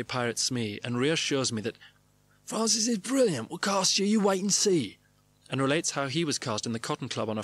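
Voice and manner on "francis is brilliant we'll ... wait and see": much higher, emphatic reassuring voice; impression of Bob Hoskins. Bob reassuring an actor about Francis, high and emphatic. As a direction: insist like a grown-up child, pitch swinging. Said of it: "while his voice gruffs, with a fluctuating expression of surprise".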